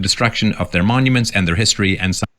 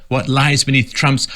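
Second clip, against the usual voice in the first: usual speaking voice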